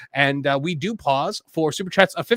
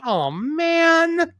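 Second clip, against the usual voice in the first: high, stretched-out lament; collective dismay; 12 semitones higher than usual. Retold or imitated. Host imitating everyone's reaction to a death, drawn-out dismay. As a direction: draw it out high, imitating shared shock. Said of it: "that was the exact reaction everyone had".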